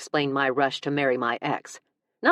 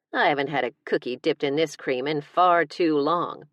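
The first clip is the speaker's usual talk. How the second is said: plain reading voice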